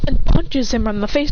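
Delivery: ordinary delivery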